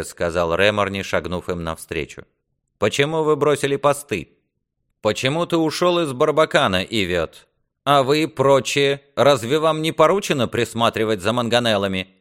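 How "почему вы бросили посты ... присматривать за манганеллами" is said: higher, louder demanding questions; stern rebuke. Acted. Lord confronts squires who deserted their posts, angry rebuke. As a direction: dress down the men with rising indignation.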